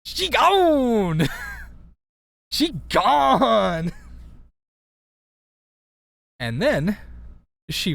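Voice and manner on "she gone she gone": sassy slang. Pretend. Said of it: "joking slang line".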